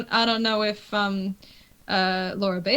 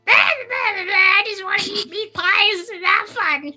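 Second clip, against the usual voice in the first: high, silly goblin squawk; dumb goblin voice; 11 semitones higher than usual. Acted. Player shows off her goblin princess early in the story, dumb goblin voice. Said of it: "do a fucking dumb goblin voice".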